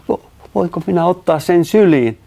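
high, stammered eager question; hesitant excitement. A narrator's reading. Narrator asks Matti to pick up the otter, hesitant excitement. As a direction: stumble on the first word, pitched high.

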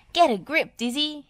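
high, loud instruction; urgent character call; clanking machine voice. A narrator's reading. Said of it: "he clanked".

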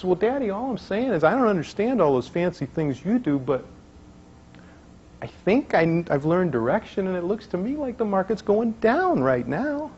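put-on little girl voice.

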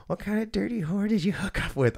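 high-pitched mock mother voice; imitated scolding mom. Nurse mom questions son on the phone, mock scolding. Quotes mom's accusing question.

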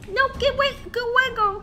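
loud, high frantic yelling; panicked yelling. Real, not acted. Panicked streamer yelling at an attacker to back off. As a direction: push them off in a panic. Ambushed, fending off enemy.

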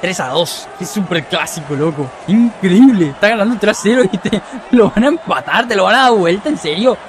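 ordinary talk

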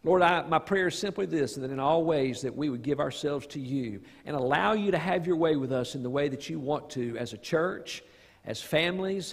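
ordinary talk

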